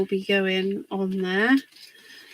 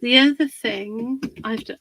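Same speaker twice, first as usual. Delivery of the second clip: ordinary delivery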